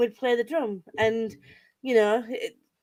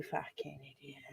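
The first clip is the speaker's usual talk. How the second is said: whispered insult; 9 semitones lower, 22 dB quieter than usual. Real, not acted. Mock insult to friend.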